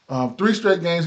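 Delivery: ordinary delivery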